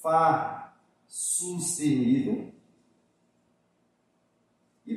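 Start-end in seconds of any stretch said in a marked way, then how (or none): 0.0-2.5 fá sustenido repeated slowly and much softer, drawn out; teacher slowly re-saying fá sustenido so students remember it. Shown as a demonstration. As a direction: let the name sink in with a slow quiet echo. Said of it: "ela pode ser chamada de fá sustenido".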